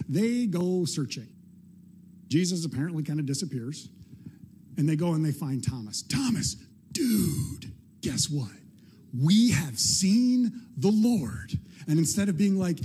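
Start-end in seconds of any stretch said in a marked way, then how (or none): 6.0-11.6 mock excited news. Disciples excitedly telling Thomas.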